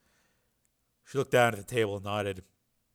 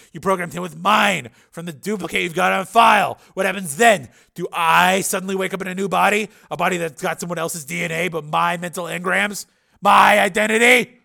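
raised, higher, pressing argument; Steele, agitated; 7 semitones higher, 9 dB louder than usual. A narrator's reading. Patient imagines his mind in another body, loud and agitated. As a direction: build to a loud indignant question.

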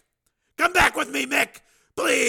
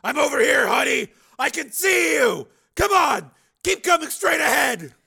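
high, loud urgent calling; 14 dB louder than usual. Acted. Father guides daughter across concourse, calling out loudly. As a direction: shout to guide a child towards you.

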